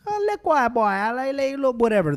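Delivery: high, yelled imitation of Jamaican Patois; mock Patois yelling. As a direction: squeal it out like locals shouting in Patois. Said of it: "they're yelling stuff in Patois".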